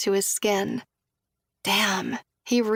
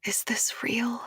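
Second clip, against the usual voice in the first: soft unvoiced whisper; 2 semitones higher, 6 dB quieter, slower than usual. A narrator's reading. Julia whispers her fear to Josh. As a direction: barely breathe the question. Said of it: "All I can manage is a whisper".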